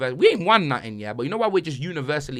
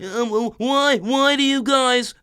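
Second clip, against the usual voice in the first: high, very slow mock Sol Campbell; mocking a footballer's voice to a friend, high and drawn out; Sol Campbell impression; 8 semitones higher, slower than usual. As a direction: drag the words out high and whiny. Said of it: "That's how he sounds".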